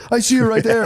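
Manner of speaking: loud, high child imitation; insistent kid. Kid argues with dad about balloon lady, high loud insistent voice. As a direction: protest like a kid caught onto a fib.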